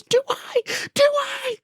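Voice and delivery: very high-pitched panicked shriek; mock hysterical yell. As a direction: imitate a man yelling in hysterics. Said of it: "Lauren and I yell".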